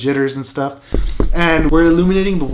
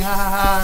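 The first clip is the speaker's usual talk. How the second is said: mock laugh, a little higher; imitated laughing; 4 semitones higher than usual. Dad laughing at his prank, imitated laugh. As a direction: act out someone's "ha, ha, ha". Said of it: "he just starts laughing".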